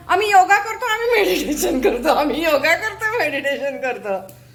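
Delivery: playful imitation of a smug boaster; mock boast. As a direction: mimic someone proudly listing their routine, laughing. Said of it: "मग तुम्ही म्हणता".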